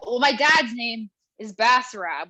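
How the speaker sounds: ordinary talk, nothing marked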